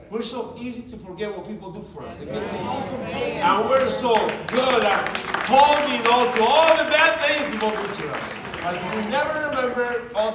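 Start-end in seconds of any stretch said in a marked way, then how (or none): 2.2-7.2 slow, loud, drawn-out emphasis; driving the lesson home to the audience, slow and loud; preaching emphasis. Real, not acted. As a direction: lean hard on each word.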